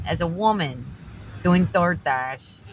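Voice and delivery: ordinary talk, nothing marked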